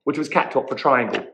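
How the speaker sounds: ordinary delivery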